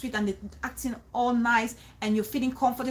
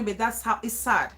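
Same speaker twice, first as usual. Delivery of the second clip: ordinary talk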